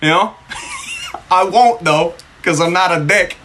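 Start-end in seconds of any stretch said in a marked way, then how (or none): 0.5-1.3 short high-pitched laugh. Real, not acted. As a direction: let out a squeaky laugh. Laugh after joke.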